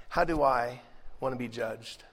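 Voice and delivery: ordinary delivery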